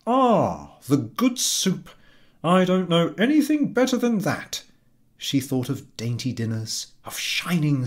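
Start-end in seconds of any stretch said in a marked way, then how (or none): 0.1-4.6 high, loud, beaming husband voice; delighted husband. A narrator's reading. Husband over dinner, beaming and loud. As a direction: relish the soup like a simple happy man. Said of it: "declared with a delighted air".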